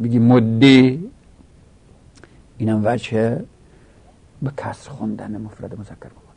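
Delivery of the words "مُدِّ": مده with an "e/i" ending, "moddi"; kasra ending. Shown as a demonstration. Teacher showing students the kasra form, مده as "moddi". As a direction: end مده on a drawn-out kasra.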